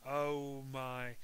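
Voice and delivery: plain reading voice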